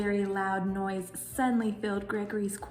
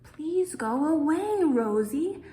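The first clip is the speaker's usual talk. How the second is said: louder, slow grumble; grumbling groundhog; 7 semitones higher, 6 dB louder, slower than usual. Acted. The groundhog grumbles at Rosie Raccoon, louder and slow. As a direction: grumble her away, slowly. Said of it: "Gregory grumbled".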